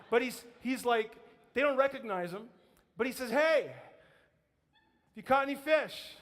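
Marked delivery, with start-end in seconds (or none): 3.3-6.2 high, loud, drawn-out holler; long, raised "Hey" carried far; calling out across water. Acted. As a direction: call to a boat from the shore. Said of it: "he's calling out across the water".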